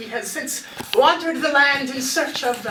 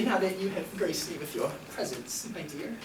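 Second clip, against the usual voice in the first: smooth flirt; 7 semitones lower, 11 dB quieter than usual. Acted. Bard flirting with woman.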